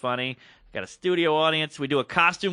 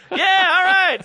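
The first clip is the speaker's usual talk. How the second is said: loud, very high, strained mock composure; hammed-up "everything's fine" host voice; forced cool; 8 dB louder, slower than usual. Flustered host answering frantic crew signals, hammed-up forced cool. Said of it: "trying to act cool".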